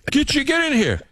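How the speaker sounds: high-pitched mock summons; urgent coworker. New England coworker calling the Southerner in, urgent. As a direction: imitate a colleague urgently calling someone over.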